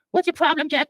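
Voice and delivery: high falsetto nurse; high-pitched comic nurse; nurse character. Acted. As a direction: call the next patient in a high voice. Acts Nurse Dobb.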